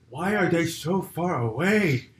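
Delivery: high, drawn-out comic panic; freaking out. Acted. Ape freaking out over binoculars, mock panic. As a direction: wail it slowly in mock alarm. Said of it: "And he's freaking out".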